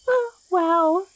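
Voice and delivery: high, delighted exclamation; genuinely wowed. Acted. Plant woman hearing the traveler's name, delighted.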